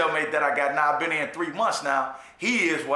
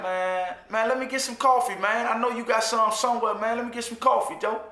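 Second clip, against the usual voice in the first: begging, higher; 6 semitones higher than usual. Retold or imitated. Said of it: "I'm like".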